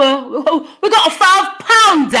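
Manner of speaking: high, loud excited announcement; excited shout. Real, not acted. Streamer announcing a five pound super chat, loud and high. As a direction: yell out the big news.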